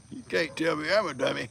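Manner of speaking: ordinary talk, nothing marked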